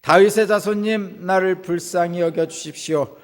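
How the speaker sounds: scripture reading. Acted.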